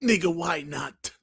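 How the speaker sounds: slow whisper; slow hushed mock line. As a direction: breathe it out slowly, mocking. Voicing Dirt Griggity.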